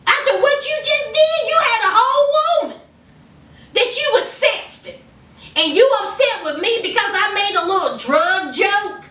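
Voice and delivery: high, heated imitation of an indignant wife; heated comeback. Wife hitting back at husband.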